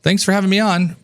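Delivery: guest reply. Retold or imitated. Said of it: "So Mark will go".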